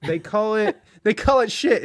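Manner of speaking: laughing through the punchline. Real, not acted. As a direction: giggle while saying it. Laughing mock verdict.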